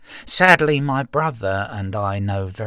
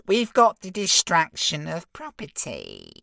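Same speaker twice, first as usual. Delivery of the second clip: very high smug little imp; giggly sneering imp; 8 semitones higher than usual. Acted. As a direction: squeak it out smugly. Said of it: "the imp continued".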